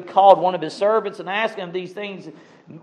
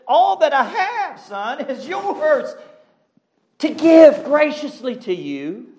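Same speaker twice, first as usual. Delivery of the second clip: gentle father; 8 semitones higher, slower than usual. Acted. Father answers son gently, preacher voicing.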